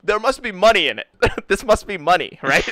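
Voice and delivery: convinced he's cracked it; mock eager hunch; hopeful guess. Musician guessing, retold.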